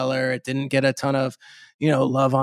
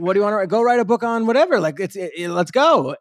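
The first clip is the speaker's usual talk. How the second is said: high-pitched, gung-ho urging; imitated eager publisher; 7 semitones higher than usual. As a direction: play an agent giving an excited green light. Acts out eager publisher and agent.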